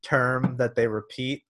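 ordinary delivery

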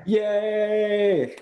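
high, drawn-out yay; celebratory cheer. Real, not acted. Castmate celebrates the accent being dropped, cheering.

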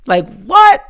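high, loud disbelieving what; amazed what. Real, not acted. Fan stunned by the bad motherfucker character, disbelieving. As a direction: shout it in disbelief.

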